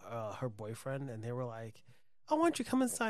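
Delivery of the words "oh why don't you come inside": lightly imitated higher-pitched invite; high-pitched mock come-on; retold coaxing invitation. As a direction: quote a stripper coaxing someone in. Quoting strippers' soft invitation.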